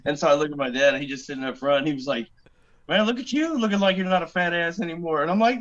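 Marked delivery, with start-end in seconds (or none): 2.9-5.2 dad teasing. Retold or imitated. Dad voiced teasing him.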